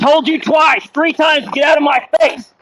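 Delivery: high, loud furious command; calm turning angry. Real, not acted. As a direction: lose patience and yell. Officer yells at man.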